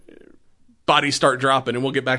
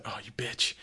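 muttered curse; 12 dB quieter than usual. Retold or imitated. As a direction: curse under your breath. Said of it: "And he's like".

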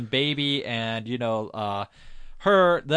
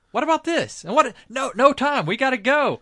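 mock panicky urging; 10 semitones higher, 4 dB louder than usual. Otis rushing Baby, sirens.

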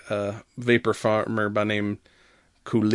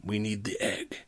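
Jawa whisper; 2 semitones lower, 14 dB quieter than usual. Retold or imitated. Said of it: "They're like".

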